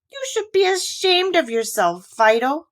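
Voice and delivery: high, scolding doll voice; scolding cry. Acted. Raggedy Ann scolds Fido for chasing the bunny, high and reproachful. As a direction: tell the dog off, shocked. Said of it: "cried Raggedy Ann".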